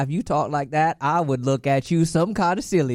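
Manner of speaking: high, loud country twang; country accent. Acted. Teasing a friend's country talk, loud country accent. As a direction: join in with a hammed-up country accent.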